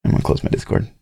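whispered under his breath; muttered to himself. Real, not acted. Fixing the call alone, he mutters to himself. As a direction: mutter it half to yourself.

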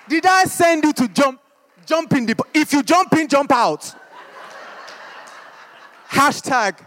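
a mother scolding her son, half laughing; scolding mum. Retold or imitated. African mum scolding her son for jumping in the pond, mock telling-off. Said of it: "my mum's like".

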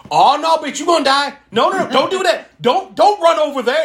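high, loud mock yell at the screen; shouting at a horror movie character; imitated yelling. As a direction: yell warnings at the movie like a heckler. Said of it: "And he's yelling the whole time".

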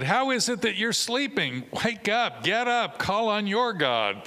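high, urgent wake-up call; a ship captain rousing a sleeper, high and urgent; captain's rousing call. Acted. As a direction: shake him awake with your voice. Captain waking sleeping Jonah.

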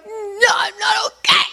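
very high tearful wail; mock ugly cry. Wife answers the doctor while crying, mock wail. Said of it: "that really ugly cry".

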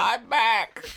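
put-on high complaining old-baby groan; weary old child. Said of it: "sounds like an old man trying to get out".

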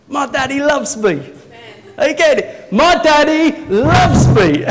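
high, loud jubilant shout, building; real excitement. Real, not acted. Preacher tells church his daddy loves him, exultant. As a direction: shout it out in exultation, stretching the last repeat. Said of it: "Well, I'm excited".

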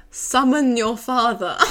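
slow, loud, imperious command breaking into laughter; mock medieval knight. Drunk visitor demands her father, mock-imperious. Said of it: "He turns into a medieval knight".

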